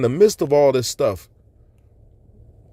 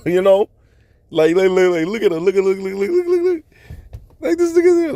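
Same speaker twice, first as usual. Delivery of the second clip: high, giggly laughing through the words; 6 semitones higher, 4 dB louder than usual. Retold or imitated. Dreamer looking back at panicking onlookers, laughing. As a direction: retell it laughing, voice climbing high. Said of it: "looked back at 'em just laughing".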